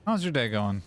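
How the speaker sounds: usual speaking voice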